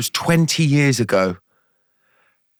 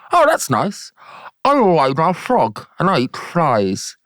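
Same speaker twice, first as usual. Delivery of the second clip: high, exaggerated wide-mouthed frog; big cartoon frog with leaping pitch; 5 semitones higher than usual. Frog introduces himself to a giraffe, high exaggerated voice.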